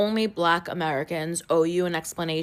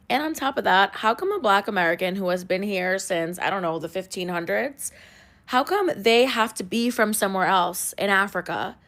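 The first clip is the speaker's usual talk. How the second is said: ordinary talk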